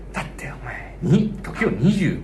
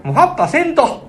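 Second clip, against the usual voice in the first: impatient Osaka man. Retold or imitated. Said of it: "みたいな感じで言ってんねや".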